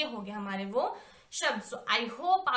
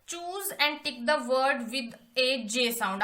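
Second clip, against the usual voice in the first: slow dictation; much slower than usual. Shown as a demonstration. Reading task aloud to class.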